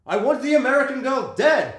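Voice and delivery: loud, high melodramatic yell; hammed-up screamed demand; mock villain shout. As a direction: bellow it like an over-the-top film witch. Acting out the witch's line.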